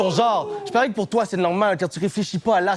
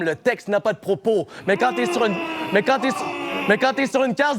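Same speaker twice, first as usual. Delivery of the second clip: ordinary delivery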